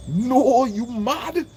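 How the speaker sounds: sudden high, loud "you mad?"; incredulous. Real, not acted. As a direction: scoff in disbelief at the idea. Incredulous retort to imagined prayer.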